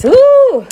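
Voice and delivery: long high, loud woo to fight off tears; emotional woo. Real, not acted. Pausing the live stream to steady herself, high loud woo. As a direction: let out a high loud woo to regain composure. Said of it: "all right, one minute, one minute".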